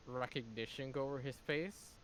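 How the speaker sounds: normal talking voice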